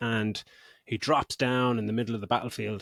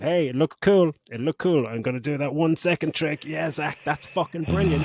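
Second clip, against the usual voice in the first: louder mock-approving sarcasm; sarcastic praise; 5 semitones higher, 4 dB louder than usual. Real, not acted. Podcaster mocking the film's writer, sarcastic praise. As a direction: praise it while meaning the opposite.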